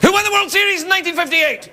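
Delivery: high, sharp quizzing voice; high mock interrogator; interrogation quiz. Quizzing the supposed German spy.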